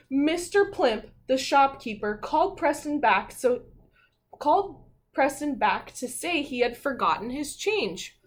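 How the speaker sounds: storybook narration, building to suddenly; story reading. A narrator's reading. Teacher reading the class a story, with a suspenseful pause. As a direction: read it like a picture book for kids.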